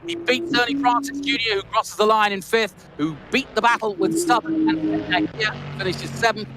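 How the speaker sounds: motor-race commentator in full flow; live race commentary. Real, not acted. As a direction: call the finish with rising excitement.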